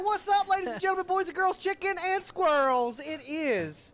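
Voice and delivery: high, fast, loud host greeting; jolly loud grill dad. Acted. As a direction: hype up the crowd. Show opener hype.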